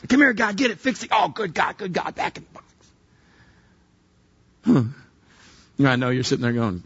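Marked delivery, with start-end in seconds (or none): 0.1-2.6 calling a dog. Acted. Preacher calling God to fix a problem like a pet, dog-owner voice. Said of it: "treated God like a big dog".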